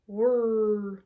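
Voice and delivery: flaps whirr. Pretend. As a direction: drone it like a motor.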